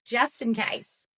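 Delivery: usual speaking voice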